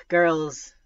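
loud, strained mom after a sigh; sigh, then call the kids to order; tense and tired. A narrator's reading. Mom annoyed after eight hours of driving, tense and tired. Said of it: "voice tense, tired".

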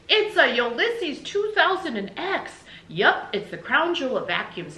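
loud, high bursts of boastful enthusiasm; proud husband gushing over his gift, building to a big finish; Mister Tickum, excited salesman pitch. Acted. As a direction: sell it like an overexcited pitchman.

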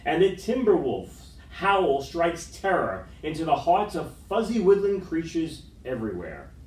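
usual speaking voice

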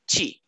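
"ti" said "chee"; T softened to CH. Shown as a demonstration. Teacher extends the rule to final TE, "ti" as "chee". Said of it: "será como a regra anterior".

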